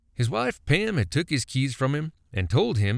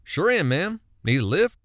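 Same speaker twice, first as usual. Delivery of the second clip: put-on bright young man, higher, smiling; chirpy, smiling; 5 semitones higher, 4 dB louder than usual. A narrator's reading. Young Billy offers Pam a lift, smiling chirp. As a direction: chirp it with a smile. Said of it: "Billy chirped with a smile".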